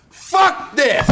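high, explosive shout; mock outraged shout. Guest allowed to flip the table, mock furious shout. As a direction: blow up in mock rage.

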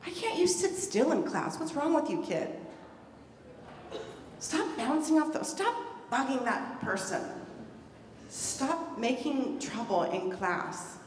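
exasperated scolding. Pretend. Teacher scolding a fidgety kid in class, exasperated. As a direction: scold them, fed up and sharp. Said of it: "instead of saying".

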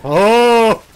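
loud startled cry; startled shout. Real, not acted. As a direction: jump at the scare. Startled exclamation in game.

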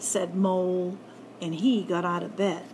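ordinary delivery, nothing marked

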